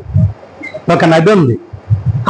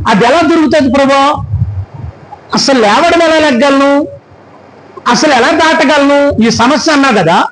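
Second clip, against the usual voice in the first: raised, pleading questions to God; preaching a believer's anxious questions; pleading preacher voice; 5 semitones higher than usual. Acted. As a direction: voice the doubter's cry, higher and urgent. Preacher voicing believer's doubts.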